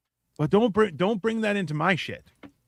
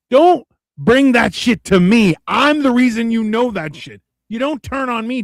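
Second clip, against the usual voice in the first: mock angry warning; 6 semitones higher, 10 dB louder than usual. Father warning his son not to turn on him, heated. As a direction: lay down the law to the kid.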